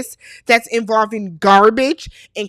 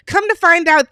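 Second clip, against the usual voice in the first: ordinary delivery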